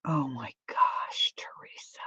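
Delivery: breathless whisper of shock; whispered gasp. Real, not acted. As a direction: whisper it, half amused, half shocked. Reading a funny comment.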